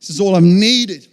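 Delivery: swelling emotional confession; emotional release. Retold or imitated. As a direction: pour it out as relief. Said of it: "getting emotional".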